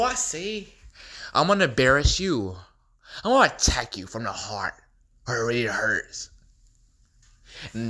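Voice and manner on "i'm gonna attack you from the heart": ordinary talk, nothing marked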